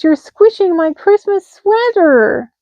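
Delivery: slow high whiny mouse; squeaky complaint; grumbling mouse. A narrator's reading. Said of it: "grumbled Mouse".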